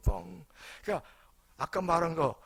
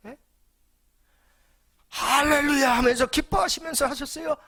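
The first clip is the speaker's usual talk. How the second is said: loud, high, emphatic sermon delivery; rising fervent preacher's call; preaching at full force; 9 semitones higher, 6 dB louder than usual. Acted. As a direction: preach it out to the whole congregation. Preacher driving home his point.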